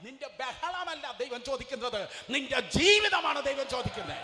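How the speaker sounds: high, loud pulpit shout; shouted preaching. Acted. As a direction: thunder it at the congregation. Preaching at full force.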